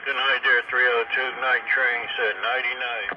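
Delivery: usual speaking voice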